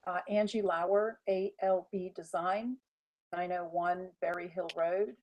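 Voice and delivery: hollow, echoing room sound; muffled, like speaking in a cave. Real, not acted. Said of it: "it sounds like you're in a cave".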